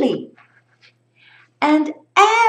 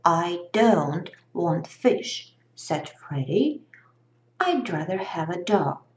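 put-on lower, soft grumpy boy; a hint of a sulky boy; 6 semitones lower, 4 dB quieter than usual. Freddy voiced low.

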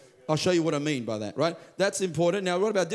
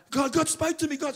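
excited; 12 semitones higher than usual. Retold or imitated. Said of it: "She was so excited that God spoke to her".

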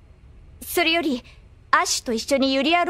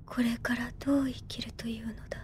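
whispering his words back to herself; a soft, unvoiced murmur, alone in thought; whispered echo; 4 semitones lower, 11 dB quieter than usual. 祖父の言葉を独り反芻. (祖父の言葉を一人でささやくように繰り返す)

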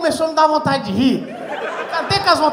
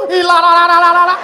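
rattling on; 3 semitones higher, 10 dB louder than usual. Retold or imitated. Rattling on nonstop on stage.

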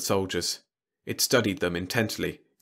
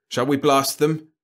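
raised, higher nervous question; 7 semitones higher, 7 dB louder than usual. A narrator's reading. Moss asks Franks about shooting, nervously. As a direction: ask urgently, on edge. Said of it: "Moss said nervously to Franks".

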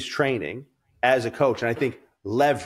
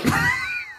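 a quick high burst of laughter; sharp high laugh. Real, not acted. Bursting out laughing at his own drug joke.

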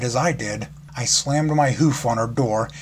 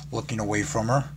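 ordinary delivery, nothing marked

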